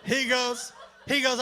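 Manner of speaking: normal talking voice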